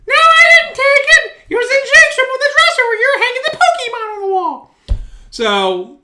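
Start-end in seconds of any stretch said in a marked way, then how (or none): 0.1-4.8 high, loud mock-wife voice; imitating his wife. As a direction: put on his wife's voice, much higher.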